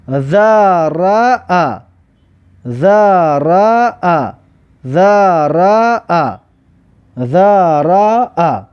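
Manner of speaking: ذرا drawn out very slowly; slow demo pronunciation. Shown as a demonstration. Teacher sounds out ذرا very slowly for pupils. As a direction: stretch ذرا slowly, syllable by syllable. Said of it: "ببینید".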